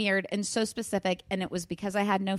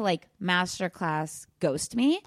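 ordinary talk

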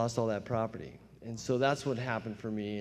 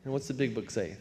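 normal talking voice

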